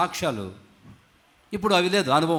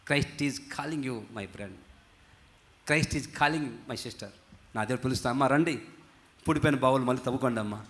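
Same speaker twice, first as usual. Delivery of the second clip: hushed, low, unhurried altar call; tender appeal; 5 semitones lower, 5 dB quieter than usual. Real, not acted. Preacher invites brothers and sisters back to Christ, soft tender appeal. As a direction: draw them in softly, with gentle pauses.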